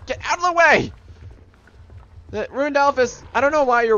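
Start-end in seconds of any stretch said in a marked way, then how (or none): 0.1-0.9 high-pitched yell to move; urgent call. Real, not acted. Calling out during play.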